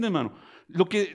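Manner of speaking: ordinary talk, nothing marked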